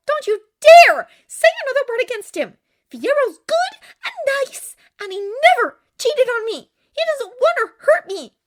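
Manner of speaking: high, loud indignant shout; loud, high outrage; furious defence. A narrator's reading. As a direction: defend him fiercely at the top of your voice. Acted quarrel, defending Fiyero.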